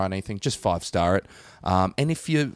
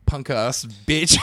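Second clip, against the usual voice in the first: usual speaking voice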